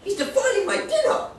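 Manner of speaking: high loud mock indignation; hammed-up fury; mock outrage. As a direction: squeal about the ruined dinner. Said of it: "really ticked off now".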